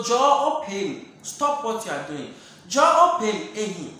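loud call of "Joe or Payne". Acted. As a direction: call out across the room. Calling someone to stop.

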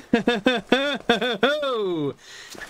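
shrill panicked laughter breaking out; high nervous laugh. Real, not acted. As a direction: laugh in real terror.

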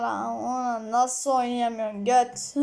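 low, slow mock whine of other players; mocking whiner. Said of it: "Şimdi diyorlar". (alaycı, kalın ve yavaş sızlanma taklidi)

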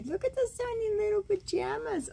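high fast cooing over tiny pajamas; cooing delight. Real, not acted. Grandma coos to grandkids over tiny pajamas, high fast delight. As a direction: squeal it like doting grandma.

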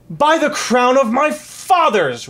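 high, loud, slow royal oath; thundering king, drawn out; furious roar. A narrator's reading. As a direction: bellow it in outrage. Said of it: "roared the king".

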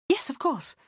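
calm them while talking over the din. A narrator's reading. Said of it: "raising my voice above the din".

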